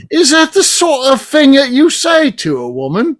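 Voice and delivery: put-on high, slow British accent of an offended woman. Imitating an English friend rebuking a man, British accent. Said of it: "British accent. And she says".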